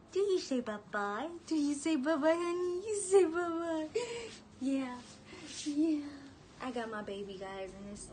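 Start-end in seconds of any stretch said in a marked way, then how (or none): 0.1-6.0 high, sing-song voice, louder. Real, not acted. Talking to her baby.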